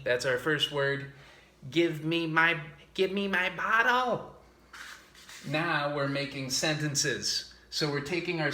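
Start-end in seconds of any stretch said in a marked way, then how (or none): 1.7-4.3 put-on higher, louder little child begging for the bottle; childlike plea. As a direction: beg like a toddler. Voicing a baby asking.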